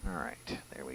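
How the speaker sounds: whispered to himself; whispering. Real, not acted. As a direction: barely breathe the words. Muttering to himself.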